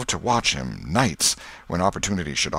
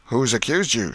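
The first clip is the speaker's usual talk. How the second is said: mild raised-voice challenge; shout; 5 semitones higher than usual. A narrator's reading. Voice from the crowd challenging the accused, mild shout. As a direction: call out, slightly higher. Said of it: "shouted a voice".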